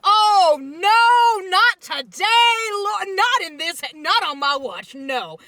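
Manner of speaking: very loud, high, drawn-out holler; imitated furious outcry, full force; outraged shout. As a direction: bellow it like an indignant protector laying down the law. Grandfather's outraged outburst, acted at full force.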